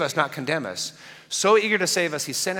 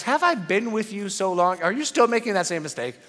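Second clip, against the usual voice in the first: weary, higher mock exasperation; exasperated eye-roll; 5 semitones higher than usual. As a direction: sigh it out like a tired teacher. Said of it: "rolling his eyes when he said".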